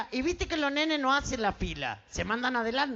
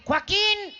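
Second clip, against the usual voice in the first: raised, drawn-out call for her son; mother calling; 7 semitones higher than usual. Acted. Mother calls her son Joaquín, high calling voice. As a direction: call the boy from another room, high. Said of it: "La mamá lo llama".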